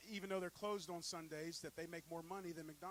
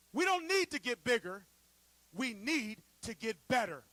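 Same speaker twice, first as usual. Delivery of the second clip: put-on louder, slow, weighty words of the old founder; stern founder's declaration; 4 semitones higher, 12 dB louder, slower than usual. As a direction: slam it down like the boss waking up mid-meeting. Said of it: "and he says".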